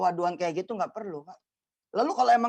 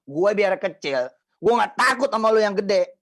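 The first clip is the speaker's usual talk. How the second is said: defiant boast; 3 semitones higher, 6 dB louder than usual. Retold or imitated. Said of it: "Tadi omongannya gini".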